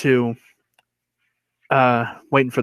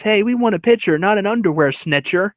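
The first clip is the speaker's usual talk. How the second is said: high, chanted heckle; taunting; 8 semitones higher than usual. Retold or imitated. Henry still mocking the pitcher from base, taunting. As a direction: jeer it like a schoolyard rhyme. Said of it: "still taunting him".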